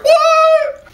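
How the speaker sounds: squeaky high disbelieving what; shocked what. Retold or imitated. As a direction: jump up in pitch with shock. Viewer reacts to sudden aging.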